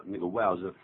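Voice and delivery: ordinary delivery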